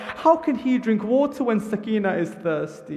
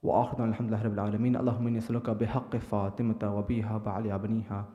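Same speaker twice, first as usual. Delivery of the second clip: low, soft supplication; quiet dua with repeated Ya Allah; closing prayer; 9 semitones lower, 5 dB quieter, faster than usual. Acted. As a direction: pray over the gathering. Closing dua after the majlis.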